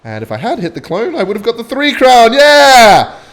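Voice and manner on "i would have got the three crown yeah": loud, high gamer yell; real hype cheer; excited outburst. Real, not acted. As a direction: shout it at the screen. Game near-win outburst.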